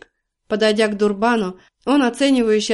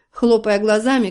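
ordinary delivery, nothing marked

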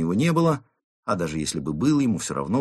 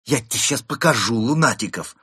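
louder, raised angry threat; spiteful threat; 4 semitones higher, 6 dB louder than usual. A narrator's reading. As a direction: threaten the suspect with open spite. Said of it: "злобно прошипел инспектор". (злобная угроза)

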